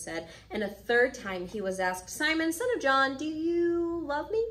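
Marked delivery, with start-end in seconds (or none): 2.1-4.4 high, drawn-out plea on YOU; pressing question. Acted. Jesus asks Peter a third time, pressing. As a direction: stretch the question out, pitched high.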